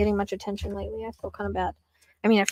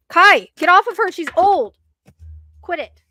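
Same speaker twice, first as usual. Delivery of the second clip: sharp, higher, louder call at the dog; scolding the dog; 7 semitones higher, 7 dB louder than usual. Real, not acted. Scolding dog off old pet.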